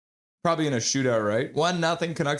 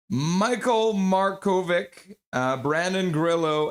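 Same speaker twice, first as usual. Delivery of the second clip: usual speaking voice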